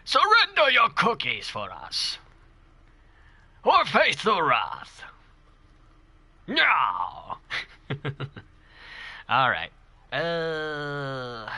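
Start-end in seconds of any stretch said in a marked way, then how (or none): 0.0-5.0 high, slow, hammed-up menacing voice; theatrical mock-wrathful demand; villain impression. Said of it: "I might do more voice impressions". 6.5-7.4 mock dramatic cry. Continues the hammed-up impression.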